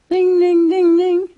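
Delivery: high, loud ringing ding; bell ding. Shown as a demonstration. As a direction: sing out the bell sound. Imitating a bell for children.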